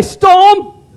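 high, loud rallying shout; from the diaphragm, with attitude. Shown as a demonstration. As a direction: lead the crowd with a belted call. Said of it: "from the diaphragm".

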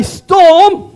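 loud, high belted call; from the diaphragm, with attitude. Shown as a demonstration. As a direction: belt it out with passion from the diaphragm. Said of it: "from the diaphragm".

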